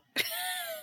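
quick amused laugh; a real laugh at the host's point; short laugh. Real, not acted. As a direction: laugh out briefly at the idea. Reacting to the book passage.

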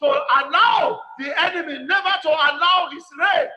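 loud, emphatic preaching prayer in short bursts; forceful prayer leading. Real, not acted. Preacher pleading with God to reject Satan's plans, forceful prayer. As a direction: cry out each petition with insistence.

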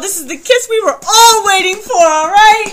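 loud, high excited squeal; gushing shout. Retold or imitated. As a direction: gush at the top of your voice. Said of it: "I was like".

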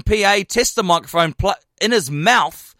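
ordinary delivery

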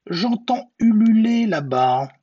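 high, loud, hooting gruffalo. A narrator's reading. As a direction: call it out high, drawing out the hoot. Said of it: "puis le gruffalo dit".

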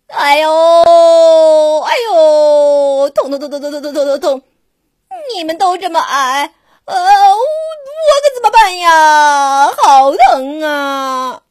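loud hammed-up groaning giraffe; wailing in pain. Giraffe complaining to the doctors, loud pained wailing. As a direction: cry out as if the tooth is killing you.

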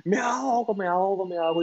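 mock drowning cry, rising high then easing; desperate panicked cry. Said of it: "el grito desesperado de él". (grito desesperado imitado, más agudo)

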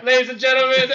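mock stage announcer. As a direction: announce it like a concert opener. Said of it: "they're like".

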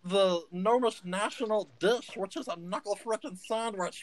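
lightly voiced gnome itching for a fight; scrappy gnome. Gnome Stitch challenges the taller man to fight, scrappy. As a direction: puff up like a tiny brawler.

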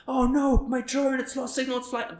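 high mock panic, dying away; dramatized Casey panic. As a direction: fake drone-disaster panic for the vlog. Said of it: "if he dramatizes it".

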